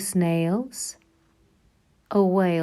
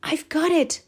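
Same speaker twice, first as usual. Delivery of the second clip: excited cry; 7 semitones higher than usual. Acted. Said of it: "she cried".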